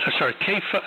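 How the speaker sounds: ordinary delivery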